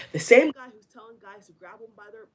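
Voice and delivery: ordinary talk, nothing marked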